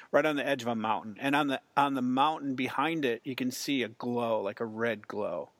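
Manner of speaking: usual speaking voice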